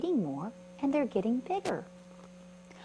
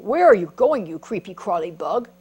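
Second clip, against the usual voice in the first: ant's voice; 10 dB louder than usual. Acted. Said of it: "an ant who said".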